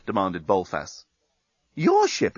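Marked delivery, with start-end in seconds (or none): 1.8-2.4 much higher surprised question. Acted. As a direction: ask it in disbelief. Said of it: "exclaimed Nettie".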